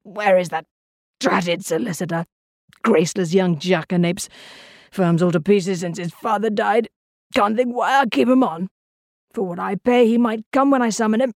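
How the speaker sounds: grumbling irritably to himself; testy, aged voice full of complaint; irascible old man. A narrator's reading. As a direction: grouse like a crotchety old client. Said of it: "The voice from the library continued irascibly".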